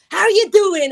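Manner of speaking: loud, high-pitched jump-scare greeting; parent-like greeting. Retold or imitated. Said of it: "I was basically like a parent".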